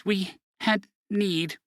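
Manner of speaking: slow, halting nervous words; stammering. Acted. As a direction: stumble over it nervously. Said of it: "Len stammered".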